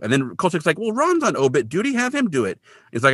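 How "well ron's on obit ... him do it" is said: high-pitched, pushing-back imitation; mock protest. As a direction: pass the job off in a high voice. Kolchak trying to dodge the assignment.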